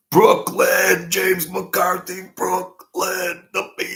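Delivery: high, drawn-out hometown shout; excited holler. Real, not acted. Streamer hails viewer James from Brooklyn, long high holler.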